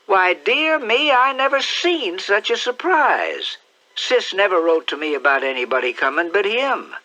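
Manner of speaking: aunt still astonished after the hugs; amazed aunt. Acted. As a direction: marvel at the surprise.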